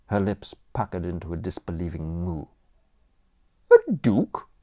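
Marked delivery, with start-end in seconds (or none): 3.7-4.5 high, loud, withering dowager voice; haughty aunt. Acted. Aunt to nephew Bertram, despairing grande dame scorn. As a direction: look down your nose at the nation's ruin.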